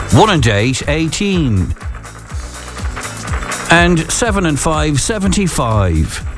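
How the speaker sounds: slow, drawn-out number calling; bingo caller. Acted. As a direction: call each number out to the hall.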